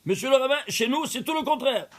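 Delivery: a congregant insisting it's the opposite; retold man's objection. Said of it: "un monsieur me dit".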